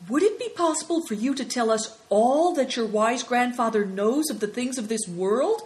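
high, eager question. Acted. Affliction asking about grandfather's wisdom, eager. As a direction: ask keenly, voice lifted. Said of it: "asked Affliction eagerly".

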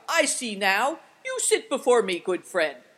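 emphatic, carrying voice; exclaiming cry. A narrator's reading. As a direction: cry out with dawning recognition. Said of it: "he cried".

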